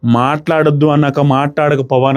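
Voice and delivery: normal talking voice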